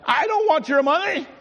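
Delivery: a sudden high protest from the pulpit; emphatic denial. Real, not acted. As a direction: cry out that you don't want it. Preacher denies wanting money.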